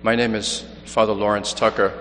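usual speaking voice

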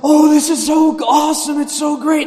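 put-on high, loud, gushing kid voice; excited child. Nephew opening his first Christmas present, high and gushing. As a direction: squeal it like a six-year-old tearing into gifts. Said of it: "he just started shaking with anticipation".

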